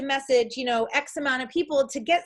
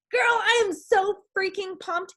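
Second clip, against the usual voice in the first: higher, slow mock gushing hype; over-hyped gushing; 7 semitones higher than usual. Coach mocks an invite style that isn't your own, gushing mock excitement. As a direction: ham up a fake over-the-top excited invite. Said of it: "If you don't talk like that".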